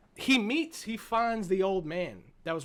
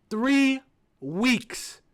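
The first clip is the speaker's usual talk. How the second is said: incredulous; 6 semitones higher, 9 dB louder than usual. Real, not acted. Stunned by the three weeks.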